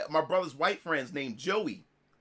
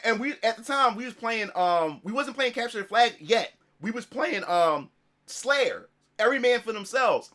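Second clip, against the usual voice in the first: ordinary talk, nothing marked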